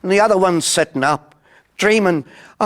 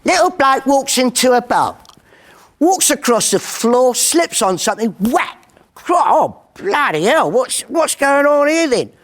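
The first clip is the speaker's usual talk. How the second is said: high, lively English pub-comic voice; pommy comic's joke voice; 9 semitones higher than usual. Retold or imitated. Comedian at the Ace of Clubs telling a joke, high and lively. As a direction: tell it like a little pommy bloke on stage. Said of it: "he'd go".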